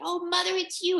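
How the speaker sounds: high, loud joyful squeal; thrilled little goat cry; delighted kids. Acted. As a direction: greet mother with childlike excitement. Kids think mother is home.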